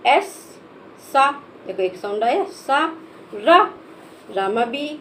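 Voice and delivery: ordinary talk, nothing marked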